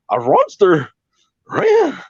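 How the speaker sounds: mangled cartoon dog speech, leaping high. Comedian answering his own Shaggy, cartoon dog voice. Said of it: "do you wanna hear some impressions".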